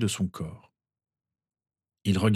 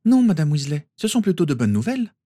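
Hermione reassuring; 8 semitones higher, 4 dB louder than usual. A narrator's reading. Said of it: "lui assura Hermione".

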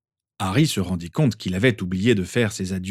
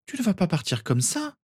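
much higher, pleading near tears; Lily near tears; 11 semitones higher than usual. A narrator's reading. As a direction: protest as if about to cry. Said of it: "protesta Lily au bord des larmes". (Lily au bord des larmes, voix bien plus aiguë)

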